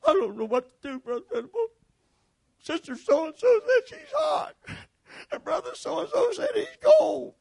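high, near-crying mock wail; flustered usher. Usher complaining to Brother Venable, a high crying wail. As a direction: play the frazzled usher on the verge of tears. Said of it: "'Cause he said".

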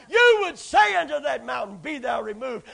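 shouted scripture. Acted. Preacher shouting the command to the mountain. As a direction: call it out.

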